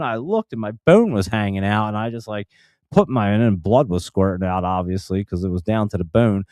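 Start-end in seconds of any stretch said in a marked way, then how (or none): none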